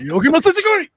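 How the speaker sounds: very high, very loud Japanese shout; shouted mock Japanese. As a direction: belt the Japanese words out, hammed-up. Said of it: "you have to greatly crescendo in volume".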